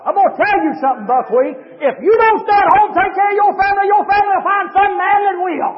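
high, loud, rising sermon cadence; shouted hellfire-and-brimstone delivery; full-force preaching. Acted. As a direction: preach it hard to the whole church. Preacher warns congregation.

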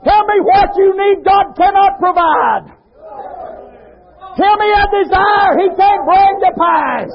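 high, loud-pitched exhortation; full-force preaching. Real, not acted. Preacher exalting God's riches to congregation: high shouted preaching.

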